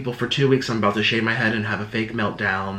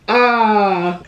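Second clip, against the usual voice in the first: exasperated cry; 7 semitones higher, 5 dB louder than usual. Acted. Mocking exasperated commenters.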